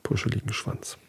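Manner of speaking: hushed, breathy aside; soft whisper over the picture. Real, not acted. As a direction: whisper it like a secret. Describing the picture to listener.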